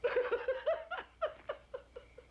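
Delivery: long, high-pitched cackle; high giggling laugh. Acted. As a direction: crack up at your own joke. Joke teller cracks himself up.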